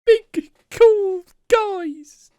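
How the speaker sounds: put-on very high, loud, drawn-out child voice; Keanu Kid. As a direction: boast like a hammed-up little boy. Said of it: "do it in your Keanu Kid voice".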